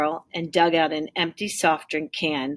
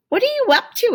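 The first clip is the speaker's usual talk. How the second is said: high, louder question of a curious mom; 7 dB louder, faster than usual. Acted. Mom asks Tim what he is up to, high and curious. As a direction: lift it up, curious mother.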